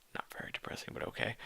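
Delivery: unvoiced snarky murmur; whispered aside. Real, not acted. Reader whispers a dig at the story.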